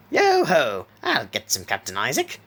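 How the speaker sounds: big pirate call, then easing off; hearty pirate accent. A narrator's reading. First mate Jack answers his captain in a hearty pirate accent. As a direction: belt the opening like a hearty pirate. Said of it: "in his best hearty pirate accent".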